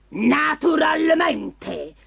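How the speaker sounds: very slow, high monster reply; boastful ogre. A narrator's reading. Ogre accepts the cat's challenge, drawn-out boastful monster voice. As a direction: draw the word out with ogre pride.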